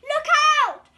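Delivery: high shrill alarm cry; panicked shout. Acted. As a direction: scream a warning at the others. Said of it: "cried the snails in panic".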